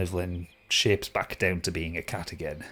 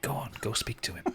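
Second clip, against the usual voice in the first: hushed, much softer spirit voice; whisper in the ear; 11 dB quieter, faster than usual. Acted. Spirit urging the player to go to the creature, whispered. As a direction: urge gently, close to the ear. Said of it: "She just whispers in your ear".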